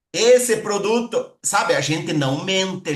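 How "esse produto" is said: ESSE stretched long, high and loud; slow, punched "Esse produto", stressing ESSE; talking it up. Shown as a demonstration. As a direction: hype it on a drawn-out "Esse". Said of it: "mas a gente aumenta".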